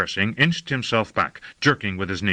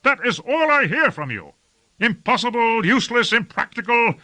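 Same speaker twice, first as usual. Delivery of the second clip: high-pitched, indignant tirade; raised, fed-up rant; exasperated cry; 13 semitones higher, 3 dB louder than usual. A narrator's reading. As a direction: list the excuses scornfully in a high voice. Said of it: "cried Lord Fayed".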